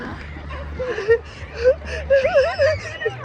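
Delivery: high, squealing laughter; helpless laughing. Real, not acted. Vlogger watching friend fall as expected, helpless laughing. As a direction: crack up into high, near-crying laughs.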